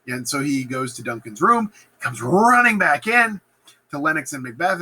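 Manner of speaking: ordinary talk, nothing marked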